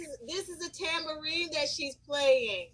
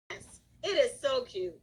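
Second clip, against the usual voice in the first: ordinary talk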